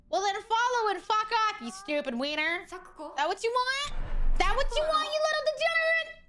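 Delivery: rising, shrieking rant; swearing tirade. Real, not acted. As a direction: build from scolding to shrieking. Yelling at chat to fuck off.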